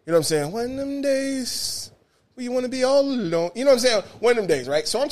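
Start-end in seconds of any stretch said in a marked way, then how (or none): 0.5-3.5 drawn-out sigh. Real, not acted. Stretching the phrase for effect.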